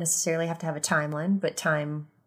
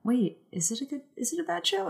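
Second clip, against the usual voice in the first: confused crowd; 9 semitones higher, 4 dB quieter than usual. Retold or imitated. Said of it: "everyone was like".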